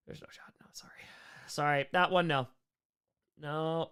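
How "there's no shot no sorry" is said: whispered aside. Real, not acted. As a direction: drop to a whisper.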